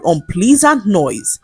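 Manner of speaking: plain reading voice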